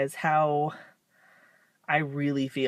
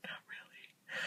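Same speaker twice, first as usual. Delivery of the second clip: whispered under the breath; 25 dB quieter than usual. Retold or imitated. To her mom, a whispered reluctant refusal. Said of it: "And I'm like".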